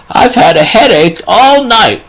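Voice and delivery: put-on high, slow old man voice; little old man. Old patient explaining himself to his nurse, little old man voice. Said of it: "he talks like this".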